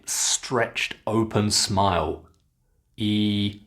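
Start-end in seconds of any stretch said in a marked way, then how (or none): 3.0-3.6 "E" as a long, held ee sound. Shown as a demonstration. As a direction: say "E" with lips stretched in a wide smile. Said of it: "just like this".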